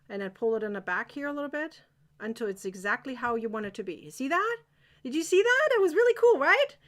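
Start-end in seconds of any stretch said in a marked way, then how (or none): 4.0-6.8 high, loud, excited showing off; thrilled. Real, not acted. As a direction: delight in the neat result. Thrilled by the stitch result.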